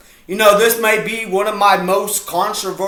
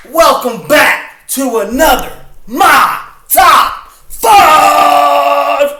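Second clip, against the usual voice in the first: loud, higher announcer call building to a shout; loud hammed-up show intro; big show intro; 8 semitones higher, 7 dB louder, slower than usual. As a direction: hype up the show title. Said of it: "after doing that amazing intro".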